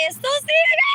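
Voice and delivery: high, loud shout. Real, not acted.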